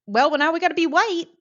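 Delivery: high, loud, quick mock reasoning; mocking imitation. Speaker mimicking her people choosing assimilation, mockingly.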